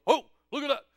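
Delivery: loud, exclaiming statesman impression; booming presidential surprise; President Obama. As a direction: announce the discovery in a big voice. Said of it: "and he goes".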